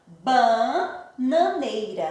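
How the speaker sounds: BA-NA-NEI-RA, drawn out in separate beats; teacher slowly sounding out the syllables of Bananeira. Shown as a demonstration. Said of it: "fazer a separação silábica".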